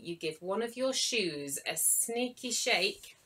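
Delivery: ordinary delivery, nothing marked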